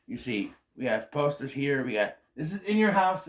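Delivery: usual speaking voice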